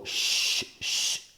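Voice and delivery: voiceless sh sound held alone, softly; isolated sh hiss. Shown as a demonstration. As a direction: make just the sh sound. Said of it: "é o único som". (som "x" sozinho, só o chiado)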